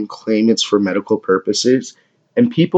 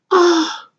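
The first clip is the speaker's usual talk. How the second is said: mock moan. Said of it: "I'll moan".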